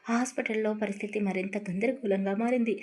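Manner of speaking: ordinary delivery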